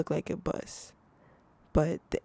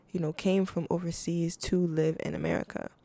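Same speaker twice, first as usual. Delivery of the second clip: normal talking voice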